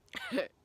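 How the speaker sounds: short high burst of laughter; real laugh. Real, not acted. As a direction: laugh out at the memory. Laughing at funny memory.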